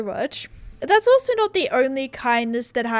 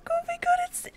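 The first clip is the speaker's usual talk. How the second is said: tiny high, hushed squeak; breath-thin, very high gushing; overwhelmed; 9 dB quieter than usual. Real, not acted. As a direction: squeak softly, almost tearful with delight. Overwhelmed reaction to receiving a gift.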